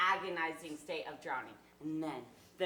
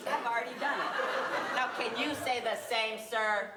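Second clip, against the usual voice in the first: laughs through the brag, higher and louder; chuckling while he gloats; pleased with himself, laughing out the words; laughing boast; 4 semitones higher, 6 dB louder than usual. Acted. Boasting gleefully to the gentleman.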